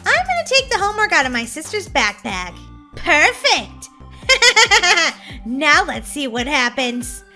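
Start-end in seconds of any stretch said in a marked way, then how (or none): 4.2-5.4 rapid gleeful giggle; mischievous cackle. Acted. Ghost Molly has taken the homework, mischievous cackle. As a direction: cackle at your own trick. Prank is set.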